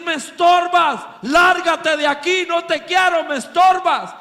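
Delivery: loud, high-pitched angry rejection; imitated shouting. Fortune-teller driving the young believer away, loud high shouting. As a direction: shout them away, each phrase hit hard. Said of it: "la mujer empieza a gritar".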